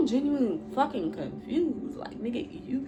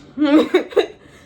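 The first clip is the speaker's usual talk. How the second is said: loud laugh; 6 semitones higher, 11 dB louder than usual. Real, not acted. Laughing at his size.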